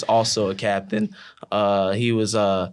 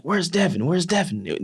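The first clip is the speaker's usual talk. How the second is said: higher, retold call for a missing teammate; puzzled searching call; 7 semitones higher than usual. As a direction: imitate teammates looking around for someone.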